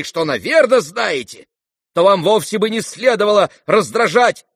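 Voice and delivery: high, loud, heated tirade; angry shrieking. A narrator's reading. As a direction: keep up a high-pitched accusing rant. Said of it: "взвизгнул Ганя". (гневная тирада на высоких нотах)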